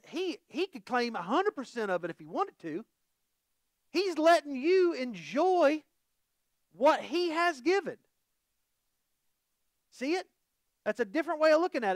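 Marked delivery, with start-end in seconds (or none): 3.9-8.0 higher, slow and drawn out; preacher stressing the gift slowly; emphatic, slow. Real, not acted. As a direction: lean into every word.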